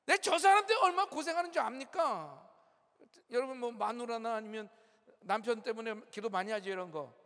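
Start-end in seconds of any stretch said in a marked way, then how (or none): 0.0-2.5 higher and louder, an imitated annoyed plea to God; mock angry prayer, raised voice; irritated complaining prayer. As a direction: act out grumbling at God over a difficult person. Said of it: "짜증나면서 화내면서 기도하는 것도".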